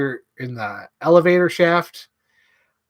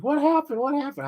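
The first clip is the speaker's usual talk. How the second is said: high-pitched, mock-panicked question; squeaky acted alarm; 12 semitones higher than usual. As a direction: play a startled crew rushing in. Night crew arrives, acted.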